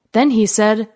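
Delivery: ordinary delivery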